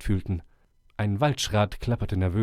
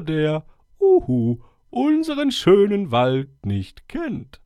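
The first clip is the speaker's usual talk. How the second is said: put-on higher, solemn old owl voice; 4 semitones higher, 4 dB louder, slower than usual. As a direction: speak like a wise old owl addressing the forest. Owl continues speaking.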